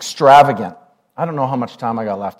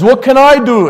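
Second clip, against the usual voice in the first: put-on loud, high-pitched old German uncle; exaggerated German-accented shout of an elderly uncle; 11 semitones higher, 10 dB louder than usual. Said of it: "in his German voice".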